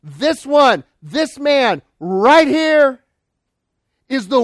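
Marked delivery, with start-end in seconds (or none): 0.0-3.0 higher and louder, slow on "this man right here"; exclaiming. Shown as a demonstration. Preacher points the congregation to Jesus, exclaiming loudly. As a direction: point hard, each word of "right here" stressed. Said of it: "I can't emphasize it or exclaim it any more clearly".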